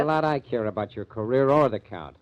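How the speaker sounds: angry retort. Acted. Mike scorns the actress.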